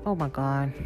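ordinary delivery

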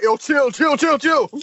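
loud, high urgent calming; calming shout. Retold or imitated. Rizzo stopping the fight on set, loud urgent calming. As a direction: hold them back, urgently. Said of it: "Rizzo was just like".

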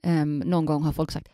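normal talking voice

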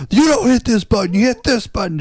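high-pitched mock screaming; high, furious imitation of a boss; imitated yelling. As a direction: yell like an enraged supervisor scolding a worker. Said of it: "then he starts yelling".

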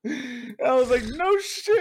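shrieking laugh into high no shit; laughing disbelief. Real, not acted. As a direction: laugh out loud in delighted shock.

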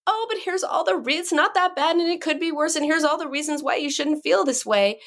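high, airy brush-off; mock-cheerful dismissal; dismissive. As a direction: imitate someone breezily talking away another's pain. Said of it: "it's dismissive".

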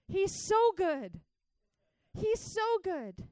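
high, loud, drawn-out preaching; impassioned preaching. Real, not acted. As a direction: proclaim it with slow, ringing force. Preaches at full force.

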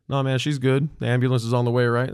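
imitated dismissive man; dismissive husband. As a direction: wave the dispatcher off.

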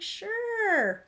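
a sing-song yes with a hidden grin; chirpy mock agreement. Mom answering her son's chart request, chirpy and high. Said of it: "I'm like".

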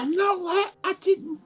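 put-on high, squeaky protesting kid; high defensive kid. Cody defending himself.

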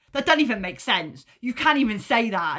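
retold incredulous protest; exasperated objection. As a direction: argue back at the telly in disbelief. Retold rant at the telly.

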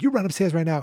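loud, urgent command; urgent order. Retold or imitated. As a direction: bark it at the kid. Laurie orders kids to hide.

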